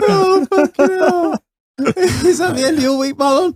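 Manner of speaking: high, slow, breathless laugh-cry; laughing disbelief. Real, not acted. Reacting to the story, laughing.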